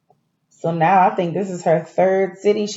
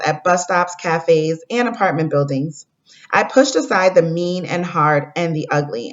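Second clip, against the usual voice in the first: storybook read-aloud to the class; reading to children. Acted. Teacher reading a story to fourth graders, gentle read-aloud. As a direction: read it like a picture book for kids.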